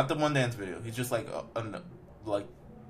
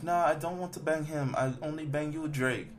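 higher, hammed-up broken-English girlfriend; mock foreign accent; 6 semitones higher than usual. Retelling the video, voicing girlfriend in a higher mock accent. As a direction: play the girlfriend with a put-on foreign lilt. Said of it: "Why does she talk like that?".

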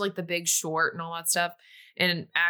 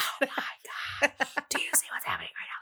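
urgent hushed shriek; whisper yelling; 7 semitones higher, 4 dB quieter than usual. Retold or imitated. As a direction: yell it under your breath. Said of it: "whisper screaming".

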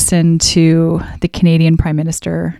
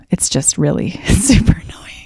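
whispered, with a laugh; whispered aside. Real, not acted. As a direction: drop to a whisper, half laughing. Aside about Trudeau's fillers.

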